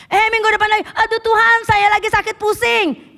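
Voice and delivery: mock sick excuse. Member faking a headache to skip church, mock whine.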